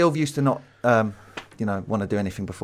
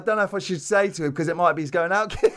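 imitating; 6 semitones higher, faster than usual. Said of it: "so he's like".

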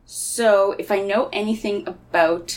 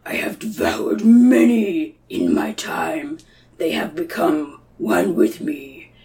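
slow, eerie whispered beast; monster whisper; 3 semitones lower, 3 dB louder, slower than usual. Acted. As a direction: hiss it out from the dark hollow. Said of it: "Maybe he's like".

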